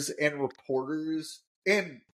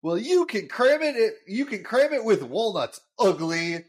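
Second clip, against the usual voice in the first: louder, higher mock-angry comeback; indignant Homer, pushed louder and higher; harsh retort; 4 semitones higher, 4 dB louder than usual. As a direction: snap back at the producer, hammed up. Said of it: "And Homer harshly goes".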